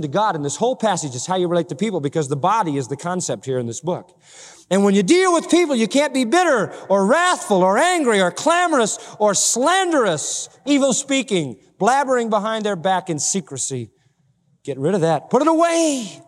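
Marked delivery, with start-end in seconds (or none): 4.7-16.2 high, forceful pulpit exhortation; preach. Real, not acted. Preacher urges church to put sin away, forceful preaching. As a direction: drive the list home, voice leaping up.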